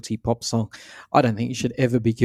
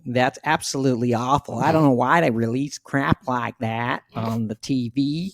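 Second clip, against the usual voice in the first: mock American accent. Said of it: "What about in America?".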